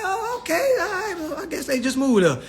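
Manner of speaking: high, softening, doubtful mock concession; bewildered acceptance. Newcomer giving up on the Charleston answer, bewildered. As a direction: trail down from a squeal, unconvinced.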